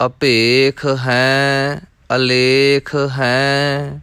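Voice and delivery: measured, rhythmic chanting of verses; scripture recitation. Acted. Teacher reciting scripture for students, rhythmic and measured. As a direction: recite each line as sacred text, evenly.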